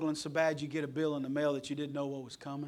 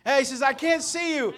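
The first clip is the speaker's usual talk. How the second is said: retold holler; 9 semitones higher, 9 dB louder than usual. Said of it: "she's hollering out there".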